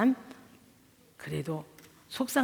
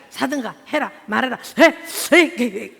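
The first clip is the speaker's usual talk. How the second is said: curt commands; 7 dB louder than usual. Retold or imitated. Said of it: "말을 육하원칙에서 길게 안 하시고 짧게".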